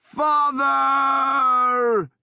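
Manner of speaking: huge high shout, full voice; shouting to the sky. A narrator's reading. Son shouting to his dead father in the rain, anguished. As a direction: bellow it up at the clouds in grief. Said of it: "he shouted at the clouds".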